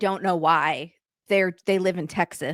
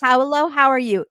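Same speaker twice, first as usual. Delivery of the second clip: breezy check-in; 6 semitones higher, 5 dB louder than usual. Shown as a demonstration. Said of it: "cursory check-in".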